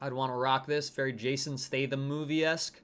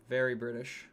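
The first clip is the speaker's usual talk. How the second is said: a light put-on British accent, softer; mock British accent; 2 semitones lower, 4 dB quieter than usual. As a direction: drop into a hint of a British accent.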